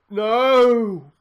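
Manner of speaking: loud, high cry of no; anguished shout. Real, not acted. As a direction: yell it at the screen. Reacting to a chance.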